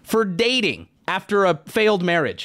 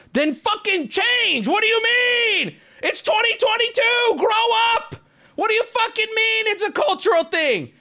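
furious rant. Retold or imitated. Telling the grown kids to change, outraged high shouting. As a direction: scream it at them in disbelief. Ranting at dad's date's kids.

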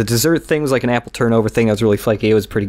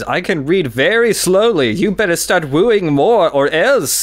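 narrator's threat; 6 semitones higher than usual. Retold or imitated. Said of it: "he just stops and he says".

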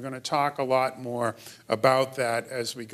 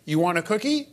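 higher-pitched question, lifted at the end; child echoing parent; 5 semitones higher, 4 dB louder than usual. Acted. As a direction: parrot the parent's offer. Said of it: "go up to the parent and say".

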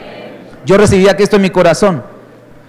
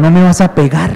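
teasing question. Shown as a demonstration. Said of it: "pregúntele al que está a su lado".

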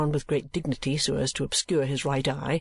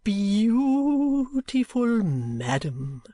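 high, very drawn-out gallantry; swooping, theatrical old suitor; respectful address; 8 semitones higher, 4 dB louder, much slower than usual. A narrator's reading. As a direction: stretch it into a lavish salute. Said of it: "addressed himself with respectful demeanour".

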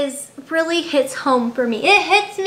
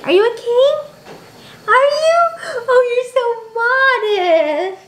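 pet talk; 8 semitones higher, slower than usual. Real, not acted. Talks to her dog Jazz.

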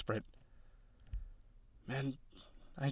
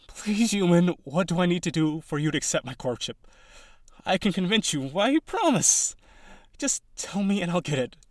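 desperate pleading; 3 semitones higher, 6 dB louder than usual. Acted. Desperate plea to frightened human.

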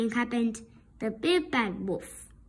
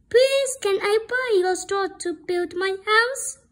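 higher, eager little pig voice; little pig asking; 6 semitones higher, 4 dB louder than usual. Acted. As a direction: ask sweetly as a small pig.

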